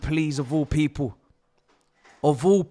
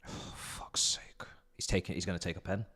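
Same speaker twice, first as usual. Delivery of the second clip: dismayed whisper; 9 semitones lower, 13 dB quieter than usual. Real, not acted. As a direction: whisper it in despair. Penalty given against his team.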